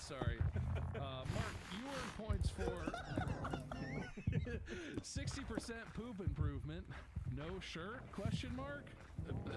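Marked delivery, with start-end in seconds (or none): 3.0-5.0 high laugh. Real, not acted. Laughs while reading points.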